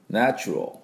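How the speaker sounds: ナチュラル as "nae-chrol"; English ナチュラル with reduced ラル; weak final vowel. Shown as a demonstration. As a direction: give the last vowel of ナチュラル only a touch. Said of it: "ちょっとだけ出す".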